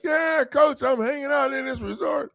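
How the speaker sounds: high mocking voice of a carefree client; a hammed-up high client voice; imitating the caller. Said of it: "He's like".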